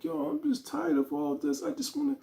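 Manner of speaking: high, whiny mock cry of a tired woman; imitating her crying. Girlfriend complaining to him about men, mock crying. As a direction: play her teary plea, lightly put on. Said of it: "all crying".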